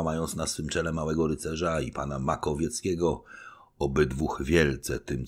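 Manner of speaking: usual speaking voice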